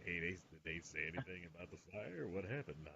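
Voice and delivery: low, hushed casual approach; playing it cool, very quietly; smooth pick-up act. Sidles up to the hot neighbor.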